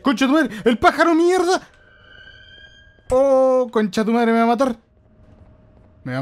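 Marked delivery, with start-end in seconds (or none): 0.0-4.8 much higher, much louder terrified swearing; panicked yelling. Real, not acted. Screaming as the bird comes to kill him. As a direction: scream as the monster bird charges.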